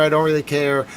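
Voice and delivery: normal talking voice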